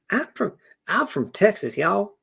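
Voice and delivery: high Texan twang, rising on y'all; bolder Southern accent. Acted. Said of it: "trying on this Southern accent".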